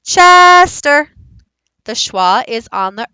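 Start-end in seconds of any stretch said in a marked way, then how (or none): 0.0-1.1 loud, high holler, "Ches" stretched long; long loud call, stepping down at the end; calling the dog. Shown as a demonstration. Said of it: "if I was calling him, I'd say".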